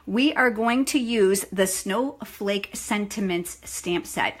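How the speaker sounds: slow, careful reading of a mouthful product name; slow deliberate product name. Real, not acted. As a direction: spell it out slowly so each word lands. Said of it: "I have to say that slow".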